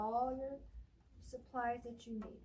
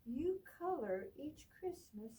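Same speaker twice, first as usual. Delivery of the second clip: put-on reading to children; 4 dB louder, slower than usual. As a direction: read it like storytime for little ones. Librarian reads picture book aloud.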